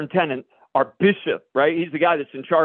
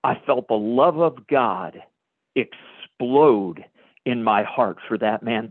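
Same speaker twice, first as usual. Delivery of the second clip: slow, lower, hushed wonder; awed and slow; 8 semitones lower, slower than usual. Real, not acted. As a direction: let the awe sink in, word by word. Awed climax of testimony.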